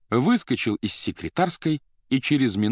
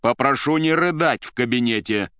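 raised, testy official order; louder and higher, losing patience; getting angry; 4 semitones higher, 5 dB louder than usual. A narrator's reading. Suit orders secretary to stop sobbing, angrily. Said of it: "уже злясь сказал вспыльчивый костюм".

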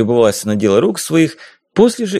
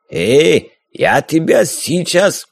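put-on hot-tempered corsair in a thick Caucasian accent, higher; mocking Caucasian-accented pirate, raised pitch; 4 semitones higher, much slower than usual. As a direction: brush her off with a swaggering Caucasian lilt. Corsair draws sabre, threatens.

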